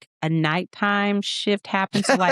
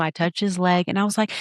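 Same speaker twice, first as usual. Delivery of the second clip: normal talking voice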